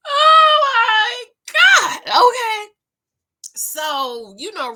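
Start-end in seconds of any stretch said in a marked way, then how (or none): 0.1-2.7 much higher, louder, slow Oh my God; laughing exclaim. Real, not acted. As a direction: cry it out through laughter. Laughing embarrassment after whisper.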